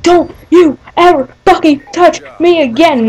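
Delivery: retold angry warning, punched word by word; pissed off. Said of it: "I was pissed as fuck".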